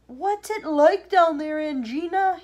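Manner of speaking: slow high holler; calling out. A narrator's reading. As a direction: call down to someone far below. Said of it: "he called out".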